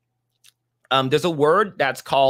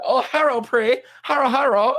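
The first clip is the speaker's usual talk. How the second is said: mock cartoon voice; 9 semitones higher than usual. Mimicking episode line.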